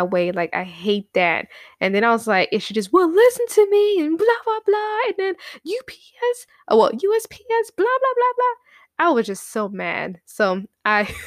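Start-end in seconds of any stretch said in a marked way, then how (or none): 2.5-9.1 high-pitched, rattled-off mock rant. Ranting about USPS and her, high mocking complaint. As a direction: rant it high and fast, blah-blahing away.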